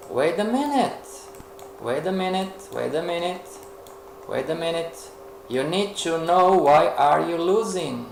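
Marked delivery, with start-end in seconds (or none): 0.1-1.1 higher, pitched-up wait a minute, as if struck by an idea; sudden realization. Acted. Teacher realizing why the trade failed, surprised. 1.8-5.0 softer, repeated wait a minute, thinking it over; quiet musing. Acted. Teacher thinking aloud to the class, quietly musing.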